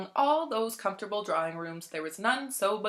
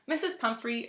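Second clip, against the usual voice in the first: ordinary delivery, nothing marked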